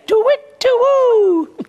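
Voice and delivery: high, loud hoots; owl hooting. Acted. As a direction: hoot like an owl, long last note. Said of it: "An owl says".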